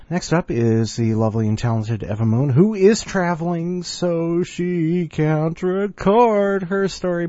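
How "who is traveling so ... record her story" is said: high voice. Pretend. High slow put-on voice.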